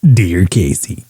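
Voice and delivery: low, slow radio host impression; Casey Kasem. Host opening a listener's letter, low slow impression. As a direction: draw out the greeting like a countdown host. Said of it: "they'd always start off with".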